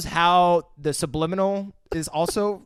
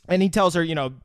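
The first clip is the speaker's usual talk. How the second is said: normal talking voice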